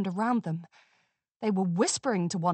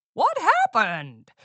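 slow, louder confused call; yell; 3 semitones higher, 4 dB louder, slower than usual. A narrator's reading. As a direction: shout into the dark. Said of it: "the announcer yelled".

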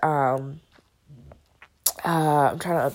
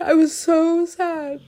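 louder, slower, high sobbing; crying; 12 semitones higher, 7 dB louder, slower than usual. Real, not acted. Crying harder while repeating she was sad. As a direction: let the sob swell on the repeat.

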